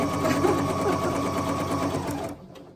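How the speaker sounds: long airy laugh, breath only; breathless laughter. Real, not acted. Apologizing to live-stream viewers, breathless helpless laughter. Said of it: "I'm so sorry".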